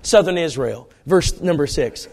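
ordinary talk

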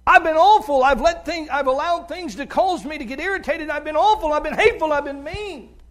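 high, loud, anguished plea for forgiveness; loud pleading confession. Acted. Father begging his son to forgive him, loud anguished plea. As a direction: cry it out, begging the child's forgiveness. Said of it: "going to your children and saying to your children".

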